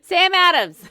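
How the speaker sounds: high, loud Boston cheer; Bostonian shout. Acted. Co-host chimes in on beer, shouting like a Bostonian.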